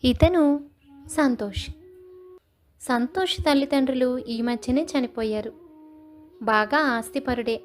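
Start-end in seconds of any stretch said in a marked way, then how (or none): none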